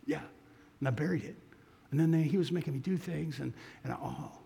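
put-on very soft, broken, teary boy's voice; tearful confession. Ashamed boy confesses to the missionary, softly weeping. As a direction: barely get it out through tears. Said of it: "the tears came".

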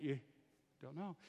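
hushed, low-volume retelling; very soft retold echo. Husband echoing his crying wife, very quietly.

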